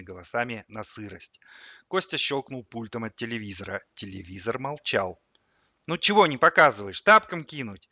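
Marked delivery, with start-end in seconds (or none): 5.9-7.9 loud, high impatient demand; annoyed threat. A narrator's reading. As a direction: badger it loudly. Boy threatens the silent TV. (раздражённо, громко угрожая)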